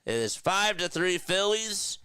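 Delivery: high, driving sportscaster call; announcer energy, pitch lifted throughout; live play-by-play. Real, not acted. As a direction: call the strikeout and wrap the inning.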